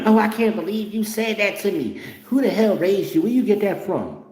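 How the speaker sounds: high, scandalized mother voice; mock offended mother. Mother reacting to her son's rude reply, high. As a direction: play mom clutching her pearls.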